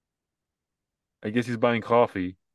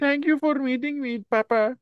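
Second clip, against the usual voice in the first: put-on high young woman voice. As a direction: read it as the grown daughter, sweetly. Acting daughter's line from the ad.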